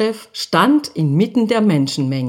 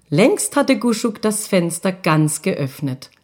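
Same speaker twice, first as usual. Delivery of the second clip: plain reading voice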